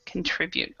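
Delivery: Contribute said con-TRI-bute; middle stress. Shown as a demonstration. Teacher confirms the student's stress on Contribute. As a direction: Contribute with TRI stressed, first syllable weak. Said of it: "the stress is in the middle".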